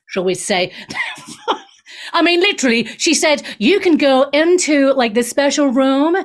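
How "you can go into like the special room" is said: lightly mocked Californian accent; a hint of a breezy Californian; California talk. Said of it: "that's how they talk in California".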